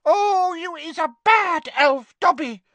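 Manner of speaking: high, loud elf wail of disapproval; squeaky moaning elf; moaning house-elf. A narrator's reading. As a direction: elf lamenting her friend's disgrace. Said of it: "moaned Winky".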